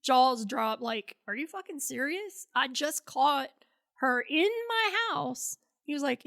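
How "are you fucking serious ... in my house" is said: imitating a stunned, indignant wife; retold incredulity. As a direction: climb high on the outraged ending. Wife's retold disbelief at denial.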